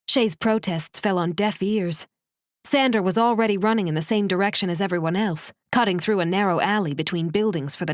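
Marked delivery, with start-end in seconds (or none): none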